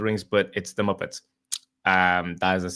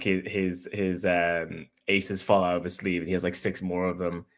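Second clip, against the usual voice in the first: ordinary talk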